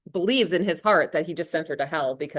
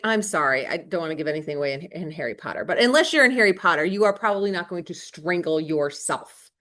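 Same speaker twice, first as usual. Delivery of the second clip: ordinary talk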